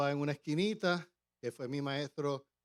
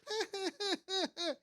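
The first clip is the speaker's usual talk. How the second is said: put-on high, airy chuckle like an old friend; high imitated chuckle; imitated laugh; 3 dB quieter than usual. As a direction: laugh the way your teacher did. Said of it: "Y él me dice".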